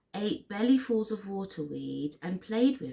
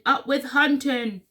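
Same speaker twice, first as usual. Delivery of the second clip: loud, higher rallying cry; shouting; 5 semitones higher, 6 dB louder than usual. A narrator's reading. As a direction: chant it like a storybook war cry. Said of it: "And they shouted".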